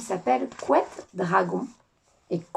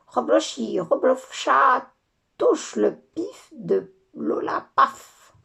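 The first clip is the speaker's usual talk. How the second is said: slow, drawn-out magic chant; spell incantation; 5 semitones higher, 4 dB louder, much slower than usual. Acted. The angry fairy casting her spell on Lola. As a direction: stretch the spell words like a witch.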